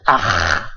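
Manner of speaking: "Aj" dropped low with a long throat scrape; low "Aj" trailing into a guttural rasp; choking throat sound. Shown as a demonstration. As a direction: say "Aj" as if getting stuck, rasping the j. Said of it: "como si uno estuviera quedándose".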